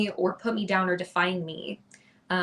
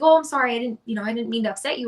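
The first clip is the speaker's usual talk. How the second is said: flustered apology; 4 semitones higher, 6 dB louder, faster than usual. Retold or imitated. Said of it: "he was like".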